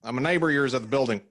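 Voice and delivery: fast Southern neighbor voice; Southern accent. Acted. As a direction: rattle it off in a Southern twang. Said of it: "the accent that you did".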